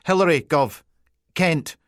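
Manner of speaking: high, loud Peterhead reply; eager Peterhead PC. Acted. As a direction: snap it out keen, in Peterhead Scots. PC giving her name.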